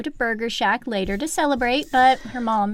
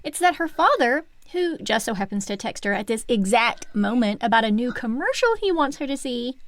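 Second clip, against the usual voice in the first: ordinary delivery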